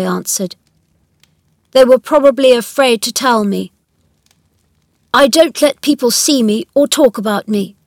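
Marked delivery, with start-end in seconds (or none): 1.7-7.8 put-on higher, louder boy's voice, complaining; emphatic young boy explaining himself; sickly, imperious boy. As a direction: voice a self-important invalid child. Narrator voices Colin.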